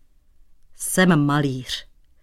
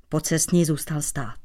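ordinary delivery

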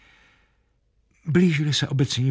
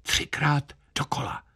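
hushed, higher stage whisper; whispered instruction; 5 semitones higher, 6 dB quieter than usual. A narrator's reading. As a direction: whisper it softly to the choir. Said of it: "Kapelník šeptl". (tichý šepot kapelníka sboru)

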